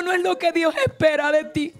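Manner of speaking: high, sustained pulpit declamation; fervent preaching. Real, not acted. Preacher challenging the church's motives for serving God, fervent preaching. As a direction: proclaim it to the whole church, pitched high.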